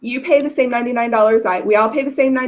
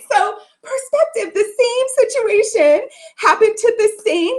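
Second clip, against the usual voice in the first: fighting back tears, pitched up; teary; 8 semitones higher, slower than usual. Real, not acted. Speaker telling listeners her story, voice breaking. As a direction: voice breaking with emotion. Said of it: "crying heard".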